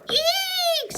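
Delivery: very high loud eek; mock scared squeak; shriek. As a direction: squeal in fright. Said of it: "she said".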